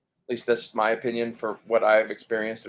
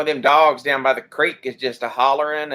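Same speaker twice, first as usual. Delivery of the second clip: put-on Oklahoma accent; 2 semitones higher, 6 dB louder than usual. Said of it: "people all over Oklahoma that talk just like this".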